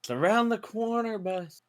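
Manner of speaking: in-character call. Acted. Player passing the warning to the boss, high-pitched call. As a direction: pitch it way up as a callout.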